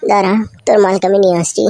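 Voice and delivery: ordinary talk, nothing marked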